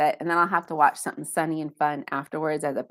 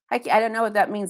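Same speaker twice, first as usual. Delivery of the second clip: normal talking voice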